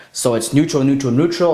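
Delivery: "neutral, neutral" kept level and steady. Shown as a demonstration. Coach demos level intonation before the rise. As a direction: keep each "neutral" flat. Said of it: "just the intonation part".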